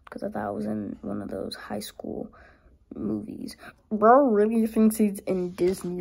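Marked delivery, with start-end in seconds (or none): none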